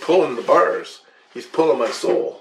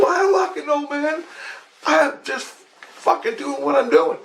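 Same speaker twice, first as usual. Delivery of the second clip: exasperated. Retold or imitated. Exasperated reply in retold dream.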